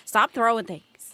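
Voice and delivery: loud playful scold; mock scolding. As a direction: snap at chat playfully. Scolding chat jokingly.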